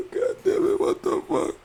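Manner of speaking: high squeaky mock wail; imitating Raven. Raven mocked, wailing about Wattpad failing. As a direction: whine it like a hammed-up tantrum. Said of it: "she will take it out on the producers".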